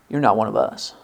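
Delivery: low, hushed guard's verdict; quiet verdict. Acted. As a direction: drop low and soft to deliver the judgement. Acted guard catches the lying Ephraimite.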